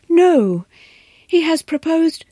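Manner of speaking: high, loud protest; shocked cry. A narrator's reading. Governess protesting to the wife, high and loud. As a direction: cry out in disbelief.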